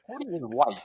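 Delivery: high-pitched host addressing the listeners; hammed-up aside to an imaginary audience; mock podcast host. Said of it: "go into hosting a podcast live".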